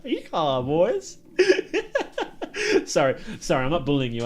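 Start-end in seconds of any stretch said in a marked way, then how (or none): 0.0-1.2 put-on Yeehaw, boys as a cowboy; playful Western cowboy call; cowboy accent. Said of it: "I just like speaking like a cowboy". 1.4-2.8 high laughter. Real, not acted. Laughing at own voice.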